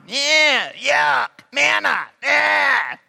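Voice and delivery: high, whiny mock disgust; whining Israelites. Preacher mocks Israelites grumbling about the manna, whiny. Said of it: "They whined".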